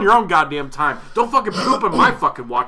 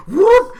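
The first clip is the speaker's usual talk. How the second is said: mock whoop; 8 dB louder than usual. People switching lanes.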